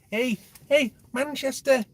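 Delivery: put-on high-pitched jokey Manchester accent; mock Manchester accent. Teasing a viewer who asked about Manchester, in a mock Manchester accent. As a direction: camp up a Manchester accent for the chat. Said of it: "that's, that's Scouse".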